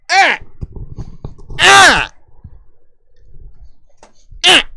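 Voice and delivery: high, loud "ah" bursts; short silly yelps. Pretend. Father being silly with his son, loud yelps. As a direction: yelp sharply, high and loud. Silly cries after singsong.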